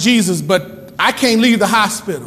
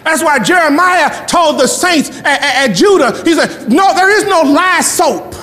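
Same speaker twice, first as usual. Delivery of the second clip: raised, higher-pitched preacher's cry; impassioned pulpit delivery; fired-up preaching; 5 semitones higher than usual. Real, not acted. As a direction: preach it hard, pitch riding high. Preaches at full force.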